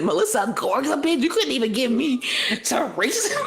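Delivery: high mock outrage breaking into laughter; pissed-off imitation. Imagining his reaction to the Melissa news, laughing mock outrage. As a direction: act indignant for him while cracking up. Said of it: "He's gonna be so pissed".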